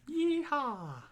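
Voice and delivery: high, hammed-up cowboy whoop; cowboy yeehaw. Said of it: "talking like Boomhauer".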